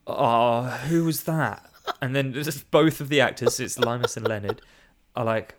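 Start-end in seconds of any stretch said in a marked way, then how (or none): 0.1-1.7 mock indignant actress. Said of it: "she's like".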